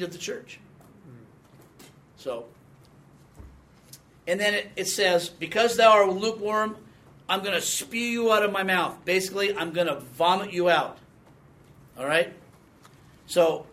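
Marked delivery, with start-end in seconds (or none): 5.3-9.0 louder, solemn preaching read of the verse; scripture reading. Acted. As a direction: proclaim it from the pulpit. Quotes Revelation to Laodicea.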